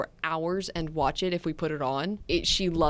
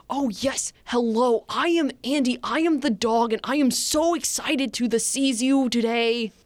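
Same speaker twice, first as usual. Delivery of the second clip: higher, eager and formal dog voice; a polite, overexcited cartoon dog introducing itself; family voicing their golden retriever; Doug the dog voice; 5 semitones higher, 4 dB louder than usual. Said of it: "we do her voice for her".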